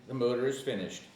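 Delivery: normal talking voice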